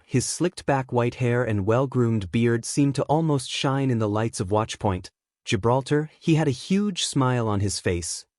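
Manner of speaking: ordinary delivery, nothing marked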